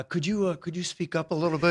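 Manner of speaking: very soft, hesitant ask; timid request. Retold or imitated. Nervous interviewer asking the actor to speak up, timid and hushed. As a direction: tiptoe round it, barely above a murmur.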